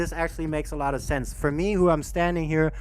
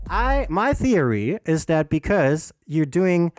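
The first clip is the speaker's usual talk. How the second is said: ordinary talk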